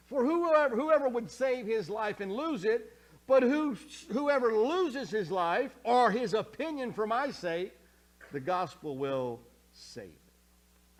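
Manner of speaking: loud, high preaching of the verse, ending slow; forceful scripture reading. Acted. Pastor preaching Jesus' words to the congregation, loud and high. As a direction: proclaim it like a fiery preacher.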